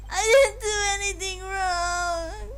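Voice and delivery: pleading up high, near tears. Acted. Streamer voicing the accused protesting innocence, high and pleading. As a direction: beg to be believed.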